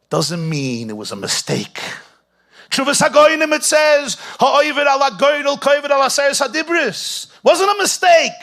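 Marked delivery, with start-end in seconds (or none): 2.7-8.3 much higher and louder impassioned sermon; full-force preaching. Acted. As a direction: preach it at the top of your voice.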